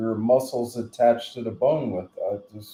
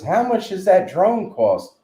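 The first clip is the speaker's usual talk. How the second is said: higher, louder mock question; imitated lady neighbour; 9 semitones higher, 5 dB louder than usual. Lady asking the caretaker about the drone, imitated in higher voice. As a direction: put on the lady's high, nosy questioning.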